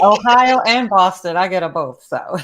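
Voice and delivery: loud laughing remark; laughing loudly. Real, not acted. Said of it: "joking about both accents".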